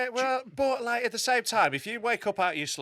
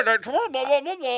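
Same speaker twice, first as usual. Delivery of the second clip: high, loud mock droning-on; mocking blather; 9 semitones higher, 4 dB louder than usual. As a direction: drown out the bore with blahs. Mocking co-host's boring watch lecture.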